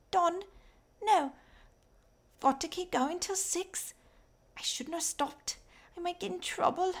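put-on high, thin, raspy little girl voice. A narrator's reading. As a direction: call out like a tired, skinny child selling coal. Fanny the mine girl speaks.

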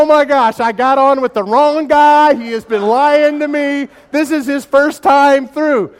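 loud, high, drawn-out wail of dismay; hammed-up roller-coaster panic, stretched out; mock panicked yell. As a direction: holler it over the rush of the ride. Acts out panicked thought on coaster.

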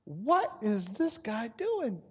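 slow, puzzled murmur; baffled. Retold or imitated. Fishermen muttering about the stranger on their boat, slow and baffled. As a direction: drag it out, bewildered.

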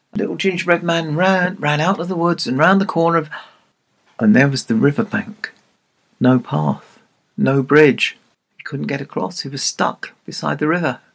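usual speaking voice

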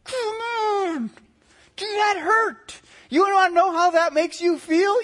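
imitating an upset older brother's yelp; hurt big brother. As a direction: cry out like a brother hit in the face. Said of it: "And he'd go".

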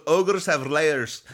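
loud, slow Scottish ogre voice; Shrek voice. Retold or imitated. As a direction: boom it out like Shrek with a Scottish burr. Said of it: "I'm trying to sound like Shrek".

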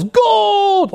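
long, high, held-out shout; soccer-announcer yell. Retold or imitated. Preacher mimicking commentator at the finish line, drawn-out yell. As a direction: stretch it out like a soccer announcer's goal call. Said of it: "he just simply yells".

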